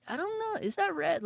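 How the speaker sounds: high-pitched unsure squeak; puzzled question. Retold or imitated. Imitating himself squinting at a highlighter, high and puzzled. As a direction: voice jumps way up in doubt.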